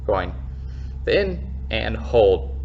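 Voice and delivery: slow, stretched breathing instruction; slow drawn-out cue. Shown as a demonstration. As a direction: hold each word long and steady. Guided breath hold cue.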